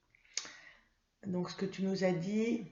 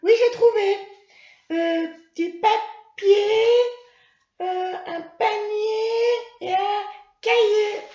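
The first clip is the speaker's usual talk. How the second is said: Mimi; 12 semitones higher, 10 dB louder than usual. Acted. Mimi the puppet answering her teacher, high child voice.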